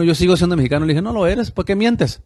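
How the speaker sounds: usual speaking voice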